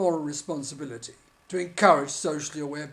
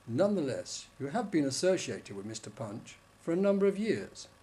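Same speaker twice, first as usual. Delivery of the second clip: normal talking voice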